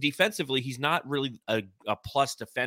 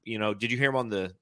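normal talking voice

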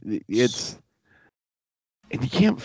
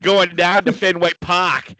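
mock Boston accent; 4 semitones higher, 4 dB louder, slower than usual. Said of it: "I'd rather listen to Boston".

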